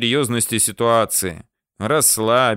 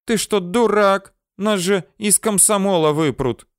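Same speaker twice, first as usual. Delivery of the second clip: high, raised female voice; indignant outburst; 10 semitones higher than usual. A narrator's reading. She scolds him over risking Komsomol expulsion, indignant outburst. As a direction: scold him in a much higher pitch. Girl alarmed at his suggestion.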